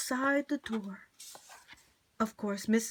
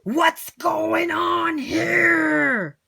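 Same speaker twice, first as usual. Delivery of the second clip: much louder, very slow angry roar; furious shout; 5 semitones higher, 7 dB louder, much slower than usual. Acted. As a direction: demand an answer in fury. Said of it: "charged with fury and shouted".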